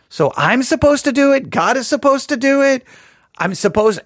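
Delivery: high-pitched, puzzled back-and-forth questions; mock confusion. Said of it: "leading you to a confusing mix of".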